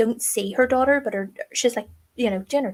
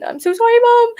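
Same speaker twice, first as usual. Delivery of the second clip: high, loud distraught cry of sorry; wailing apology; 12 dB louder than usual. Retold or imitated. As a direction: break into a loud, high wail on sorry mom. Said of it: "audibly upset".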